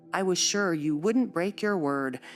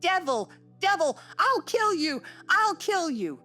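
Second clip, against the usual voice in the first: shrieking; 14 semitones higher, 3 dB louder than usual. A narrator's reading. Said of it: "he shrieked so shockingly".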